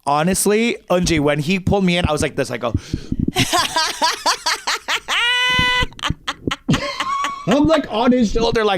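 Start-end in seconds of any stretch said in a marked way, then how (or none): 2.8-7.4 long squealing fit of laughter; long high laugh. Real, not acted. Comic recalls the awkward hug, laughing helplessly. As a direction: lose it completely, high and long.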